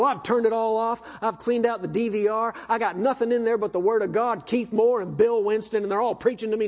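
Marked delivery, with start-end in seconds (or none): none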